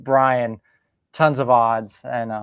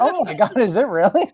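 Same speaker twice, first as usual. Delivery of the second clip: mock excitement; 7 semitones higher than usual. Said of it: "I was like".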